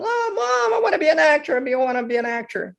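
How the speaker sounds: put-on high, whining child's plea; whiny kid begging. Young son whining to his mom to let him act. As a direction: beg like a little boy who wants it badly.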